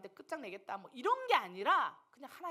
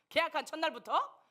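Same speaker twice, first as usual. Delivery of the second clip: ordinary delivery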